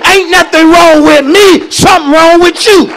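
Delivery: forceful, emphatic pulpit delivery; full-force preaching. Real, not acted. Preacher challenging the congregation, full-force preaching. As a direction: preach it hard, driving each phrase home.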